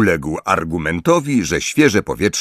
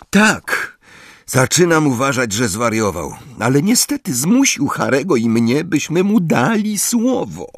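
higher, exasperated admission; Hermione grudging; 7 semitones higher than usual. Acted. Hermiona admitting Hagrid is mad, exasperated. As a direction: concede it with a sigh in the voice.

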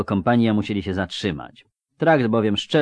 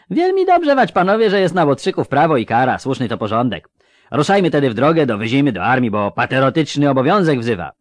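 fawning toothless rogue; 6 semitones higher, 7 dB louder, faster than usual. Acted. Szczupak grins toothless, fawning.

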